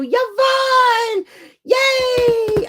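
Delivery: high, drawn-out happy squeal; excited cheer. Real, not acted. Welcoming a favorite viewer who just joined, thrilled.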